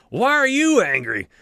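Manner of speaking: high put-on voice, comic puzzlement; mock baffled brawler. Mob guy presses the other on why he's angry, comically puzzled. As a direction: ask it again, hamming the confusion. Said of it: "and he's like".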